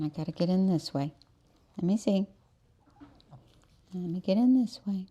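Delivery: normal talking voice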